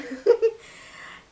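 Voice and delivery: breathy burst of laughter; quick high laugh; airy laugh. Real, not acted. Laughs at her own remark.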